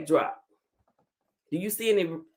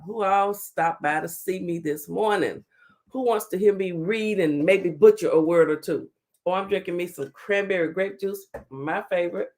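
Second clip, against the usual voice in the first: usual speaking voice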